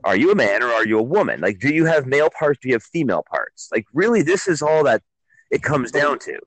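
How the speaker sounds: normal talking voice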